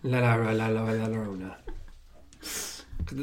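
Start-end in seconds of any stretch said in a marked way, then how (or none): 0.0-1.5 low droning "La La La" running into "Llorona"; playful garbled name. Pretend. Fumbling the film title for a friend, playfully garbled. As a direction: pile up the "La"s on one low note before "Llorona".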